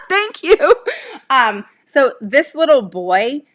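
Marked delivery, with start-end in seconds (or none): 0.0-0.7 bright laugh then thanks; laugh, then thank her warmly. Real, not acted.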